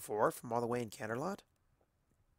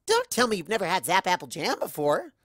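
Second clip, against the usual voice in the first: higher and louder; playful disbelief; 6 semitones higher, 5 dB louder than usual. Acted. Pinky teasing the narrator, high and incredulous. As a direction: tease in mock shock.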